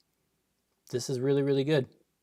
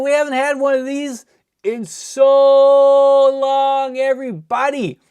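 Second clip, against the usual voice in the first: much higher, much louder, yelling with joy at the pull; ecstatic shouting; 13 semitones higher, 14 dB louder than usual. Real, not acted. Streamer pulls the cold foil Fable for viewers, ecstatic shouting. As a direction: celebrate the big pull at full volume. Pulling a rare chase card.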